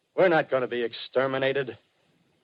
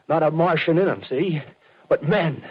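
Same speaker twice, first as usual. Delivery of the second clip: higher and louder second time; excited repeat; 6 semitones higher, 4 dB louder than usual. Acted. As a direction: build up the thrill. Artilleryman repeats, more excited.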